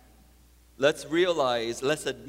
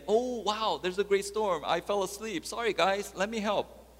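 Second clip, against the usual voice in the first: lightly acted apology, pitched up; imagined apologetic reply; 9 semitones higher than usual. Imagined Jesus apologizing to the disciples, pitched-up mock apology. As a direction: play a mock-surprised, eager-to-help reply. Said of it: "Rather than saying".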